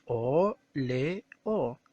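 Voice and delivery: "o, le, o" as three separate beats; syllable by syllable. Shown as a demonstration. Teacher separating óleo into syllables, one syllable at a time. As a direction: give each syllable of "o, le, o" its own beat with pauses. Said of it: "separamos en sílabas".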